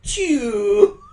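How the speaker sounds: loud, high "chu" smooch sound; high, loud cutesy smooch; kiss noise. Acted. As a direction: squeal out a drawn-out kiss.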